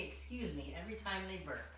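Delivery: usual speaking voice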